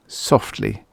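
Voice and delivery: ordinary delivery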